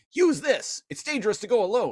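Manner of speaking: put-on high, quick old man hint; mock game mentor. Galvatron handing over the disc like a game mentor, high and quick. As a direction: rattle off the Zelda line. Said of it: "He's like".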